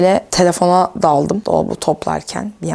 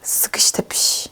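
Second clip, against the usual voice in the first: 1 whisper; 5 dB quieter, much slower than usual. Real, not acted. Cramped closet.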